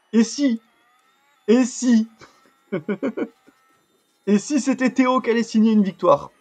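high, loud, hopeful build-up; excited anticipation. Real, not acted. Gamer imagines Théo signing a victory, excited. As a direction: tease the possible win like a commentator. Teases a possible win, commentator-like.

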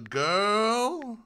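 usual speaking voice

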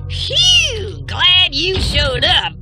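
high, slow, hugely relieved; relieved greeting. Acted. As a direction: whoop with relief at a rescuer's arrival. Rescued, greets the rescuer with relief.

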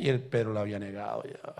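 ordinary talk

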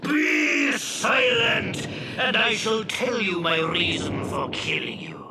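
long high shout, settling into slow menace; booming, commanding genie. Acted. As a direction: bawl the order, then lay down the threat. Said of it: "he shouted so loudly".

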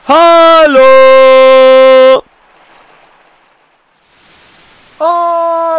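high, loud call into the distance. Acted. Calling for Manafi, loud distant hail. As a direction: call out to hear the echo.

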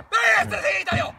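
slow, loud yell to let go; real angry shout at the boater. Real, not acted. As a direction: bark it out, furious and slow. Angry fisherman yells at boater.

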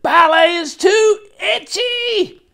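put-on high, drawn-out child's complaint; very loud. A narrator's reading. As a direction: complain at full volume. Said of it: "I was very loud".

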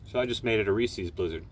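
talking while chewing candy; muffled chewy speech; mouth full chewing. Real, not acted. Seen 2: Bit candy at -4; lips pressed, jaw working during words.

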